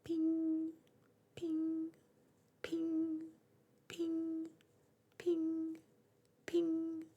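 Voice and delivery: very high, ringing ping; clock chiming. A narrator's reading. The clock in the square striking, high ringing chimes. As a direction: chime each ping like a bell.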